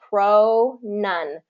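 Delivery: two syllables stretched and separated; slow pronunciation drill; slow mouth training. Shown as a demonstration. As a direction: go slowly from one syllable to the next. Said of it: "train your mouth slowly".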